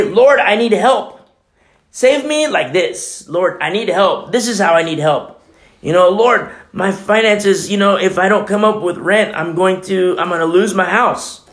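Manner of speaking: high, loud desperate plea to God; mock desperate prayer for help; pleading prayer. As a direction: beg the Lord for rescue.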